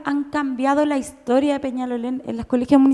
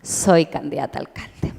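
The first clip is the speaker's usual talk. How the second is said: lower, grave mock-devout announcement; lightly voiced dutiful believer; mock obedient reply; 10 semitones lower, 3 dB quieter than usual. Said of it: "yo digo". (imitación devota y grave, más baja)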